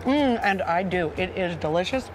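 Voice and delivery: talking with a mouthful; chewing while speaking; mouth full. Real, not acted. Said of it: "I'm talking with my mouth full".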